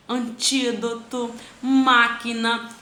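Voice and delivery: slow antídoto, máquina, leaning on TÍ and MÁ; stressed antepenultimate syllable. Shown as a demonstration. Teacher shows examples, TÍ and MÁ slowly stressed. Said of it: "a minha tônica é o ti".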